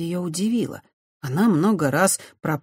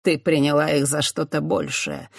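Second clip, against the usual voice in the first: ordinary delivery, nothing marked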